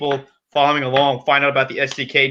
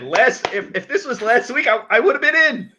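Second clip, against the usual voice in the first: climbing, high-pitched indignant protest; mock-mad complaint; 9 semitones higher than usual. Real, not acted. Host tells Alex he missed out, mad protest climbing higher. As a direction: protest it, pitch rising as you go. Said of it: "I'm so mad".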